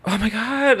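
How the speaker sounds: hammed-up dismay; mock dismay. Real, not acted. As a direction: wail it in playful dismay. Mock dismay at last words.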